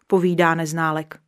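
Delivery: usual speaking voice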